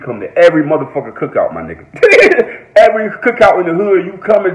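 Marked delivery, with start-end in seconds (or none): 1.9-2.7 high loud laugh. Real, not acted. Reacting to his cookout joke, laughing loudly.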